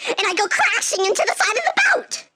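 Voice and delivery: high, hammed-up shriek; dramatic screech. Storyteller reliving hitting the boat, shrieking. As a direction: screech the crash in horror.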